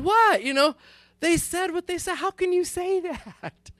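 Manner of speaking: a husband mockingly defending the kids against his wife; mock indignation. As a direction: play wounded disbelief for laughs. Protesting wife's verdict, mock outrage.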